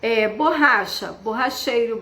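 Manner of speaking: ordinary talk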